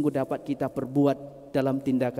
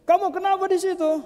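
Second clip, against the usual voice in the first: calling down; 6 dB louder than usual. Acted. Passer-by calls into pit.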